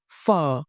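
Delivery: phở with a mid start, small dip and rise; Northern tone demo. Shown as a demonstration. Teacher shows learners the phở tone clearly. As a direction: say phở like a little question. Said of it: "Use the high tone, dipping raising tone".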